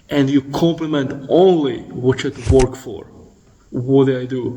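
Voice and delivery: usual speaking voice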